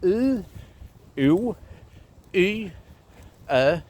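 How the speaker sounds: U, U, Y, Ö as separate pure vowels; rune vowel sounds. Shown as a demonstration. Teacher listing the U rune's values, isolated vowel sounds. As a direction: say U, U, Y, Ö slowly apart, as sounds. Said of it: "these sounds are".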